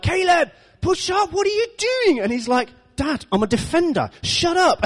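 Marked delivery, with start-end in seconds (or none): 0.0-2.2 high, stretched-out hammed-up yelling; touchline dad shout. Dad yelling at son's match. 3.0-4.8 high-pitched imitation of an indignant child; mock protest back at a shouting dad; exasperated son's retort.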